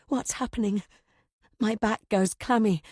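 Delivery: usual speaking voice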